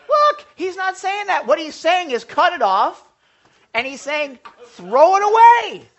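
high, loud, slow pounding it home; fervent sermon climax; emphatic preaching. Real, not acted. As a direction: preach it at full force. Preacher driving the point.

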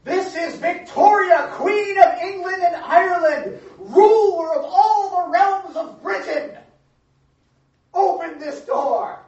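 high, loud, slow regal proclamation; haughty queen. Acted. Queen announcing her titles at the locked door, loud and regal. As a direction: proclaim every title grandly. Queen demanding entry again.

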